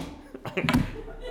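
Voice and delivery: short laugh at own joke. Real, not acted. Preacher amused after his own "boo", laughing. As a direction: burst into a brief chuckle.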